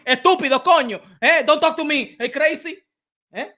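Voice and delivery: put-on brash Dominican accent, voice up high; Jason Rosario impression. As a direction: do a hyped Dominican boxer calling out the Charlos, Spanglish accent. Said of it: "from Jason Rosario Banana".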